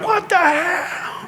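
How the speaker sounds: high, long drawn-out mock yell; imitated outburst over the band; retold shout. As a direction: stretch the swear into a high wail. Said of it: "I shouted".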